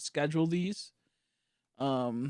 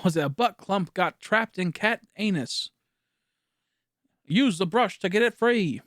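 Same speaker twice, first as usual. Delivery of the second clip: loud, higher mock announcer; Lego City advert parody; 5 semitones higher, 6 dB louder than usual. Streamer trying a Lego City meme about the cat, parody announcer. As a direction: belt it out like a hammed-up toy advert. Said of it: "I'm trying to Lego City meme".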